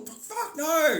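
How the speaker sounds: high, loud outraged no; shouted refusal. Acted. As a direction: yell it in disbelief.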